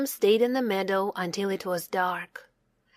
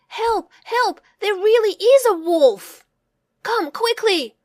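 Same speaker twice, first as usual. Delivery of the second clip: high, loud desperate cry for help; the boy begging the village, shouting; 12 semitones higher, 8 dB louder than usual. A narrator's reading. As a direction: scream it to the village. Real wolf, calling for help.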